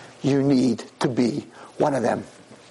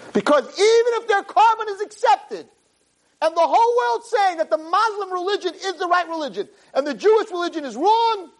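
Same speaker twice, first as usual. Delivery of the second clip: fiery preaching. Acted. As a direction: preach it with full fire. Rabbi preaches at full force.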